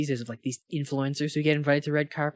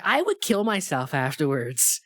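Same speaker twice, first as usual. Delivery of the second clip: ordinary talk, nothing marked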